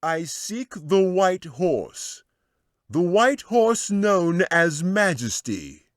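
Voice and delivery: high, slow called-out declaration; wizard calling out. Acted. Wizard announcing to all what he seeks, loud slow call. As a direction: announce it to the whole hall. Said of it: "called out in a loud voice".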